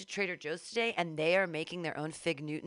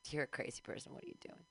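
teasing whisper; 2 semitones lower, 11 dB quieter, faster than usual. Real, not acted. Teasing listeners softly.

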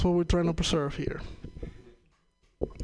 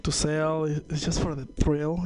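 ordinary delivery

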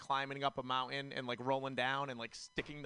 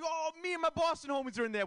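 much higher, hammed-up eager voice; mock eager self; 13 semitones higher than usual. Comic retelling his boast about Boston friends, high mock eager. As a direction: play yourself as an overeager fan. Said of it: "I'm like".

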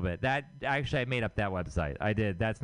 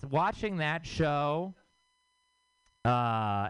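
normal talking voice

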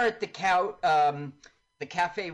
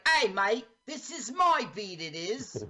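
higher, mock Cockney cabbie warning someone off; a cab driver's Cockney, shooing off a rival; Cockney accent; 5 semitones higher than usual. A podcaster imitating a Cockney cabbie telling an assassin off, in Cockney. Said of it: "with his Cockney accent".

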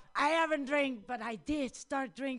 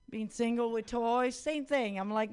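ordinary talk, nothing marked

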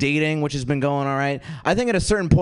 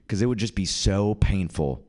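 ordinary talk, nothing marked

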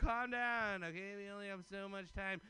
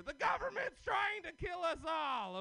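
shouting; 7 semitones higher, 4 dB louder than usual. Acted. Conspiracy rant on stage.

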